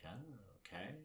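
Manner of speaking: low, soft, deadpan acceptance; unfazed, fearless reaction. Retold or imitated. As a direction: shrug it off quietly. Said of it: "he doesn't even show fear".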